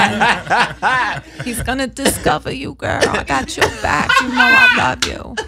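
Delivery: high Jersey guy voice with a lisp; hammed-up smooth talker with a lisp; Bob Sumner impression. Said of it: "he talks like this".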